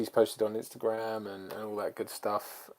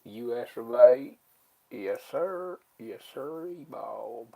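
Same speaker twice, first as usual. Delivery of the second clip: drawn-out mock American accent, pitched up on "sir"; hammy fake American accent; bad American accent; 3 dB louder, much slower than usual. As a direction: put on a bad American accent, stretching the words. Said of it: "That's a bad accent".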